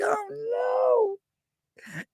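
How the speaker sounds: high, far mock cry of dismay; mock wail. Mocking her over the internet, high fake wail. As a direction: wail like the sky is falling. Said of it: "I was like".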